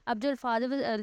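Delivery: normal talking voice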